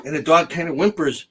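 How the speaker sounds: ordinary talk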